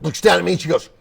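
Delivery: ordinary delivery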